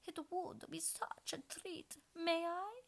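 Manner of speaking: high, slow cooing queen; cooing like a pigeon. A narrator's reading. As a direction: coo sweetly like a pigeon. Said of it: "like the cooing of a pigeon".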